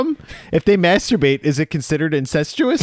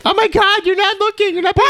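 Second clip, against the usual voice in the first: backseat driver yelling. Acted. Acting nagging twin passenger.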